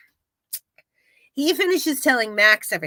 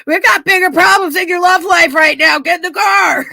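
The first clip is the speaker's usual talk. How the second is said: urgent shout; 6 semitones higher, 7 dB louder than usual. Retold or imitated. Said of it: "And he's like".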